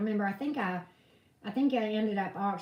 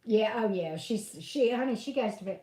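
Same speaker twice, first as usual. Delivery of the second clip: usual speaking voice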